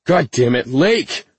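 loud, high furious yell; angry shout. Acted. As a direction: shout it in fear and rage. Panicked search, she vanished.

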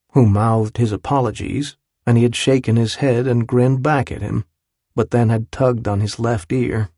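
ordinary delivery, nothing marked

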